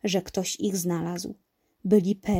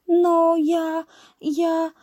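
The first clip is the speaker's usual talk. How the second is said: high, hesitant kitten voice; a timid cat speaking up; shy little cat; 8 semitones higher, 5 dB louder, much slower than usual. A narrator's reading. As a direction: stammer it shyly, pitched high. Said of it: "powiedziała nieśmiało kotka".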